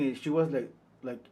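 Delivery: usual speaking voice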